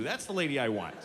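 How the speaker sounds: ordinary delivery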